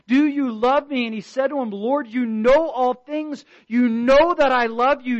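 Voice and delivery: preacher hammering home every sin to the congregation, loud and high; preaching at full force. Acted. Preacher pressing the point to his church, full-force preaching. Preacher reading John 21 dialogue.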